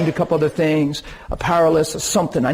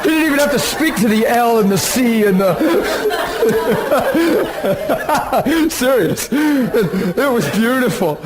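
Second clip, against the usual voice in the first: higher-pitched talk breaking into real laughter; laughing; 6 semitones higher than usual. Real, not acted. Preacher amused by the husband's tiny prayer, laughing. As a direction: crack up while getting the words out. Cracks up at his own imitation.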